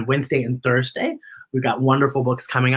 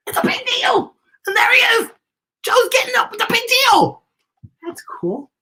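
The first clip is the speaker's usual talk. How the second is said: much higher, bubbling with excitement; excited; 3 dB louder than usual. Acted. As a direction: squeal it out for the kids. Excited reaction to picture.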